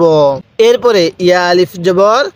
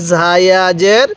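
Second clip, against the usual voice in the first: slow drill; much slower than usual. Shown as a demonstration. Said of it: "পড়ুন".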